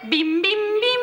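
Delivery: high sung three-note ding-dong; sung airline chime. Acted. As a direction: sing it like an airport announcement chime.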